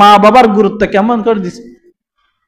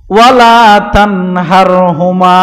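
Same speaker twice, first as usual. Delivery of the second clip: slow, drawn-out chanted Arabic verse; ধীরে সুর করে কুরআন তিলাওয়াত; Quran recitation; 3 semitones lower, slower than usual. Acted. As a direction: intone the verse slowly like tilawat.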